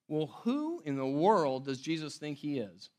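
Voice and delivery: normal talking voice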